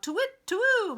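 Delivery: high, loud hooting call; owl calling out. Acted. The owl cheers the duck swimming, high loud hoot. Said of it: "Owl calls out".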